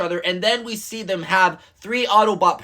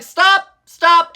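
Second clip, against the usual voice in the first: high, loud retold yell of stop; mock yelling stop; 7 semitones higher, 7 dB louder than usual. Starscream pleading with the humans, high loud mock yell. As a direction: yell stop twice like a trapped robot. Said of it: "Starscream starts getting enraged".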